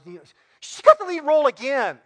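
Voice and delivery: mock-whine the complaint; husband mimicking his wife's upset whine; upset wife whining. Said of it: "she's all upset".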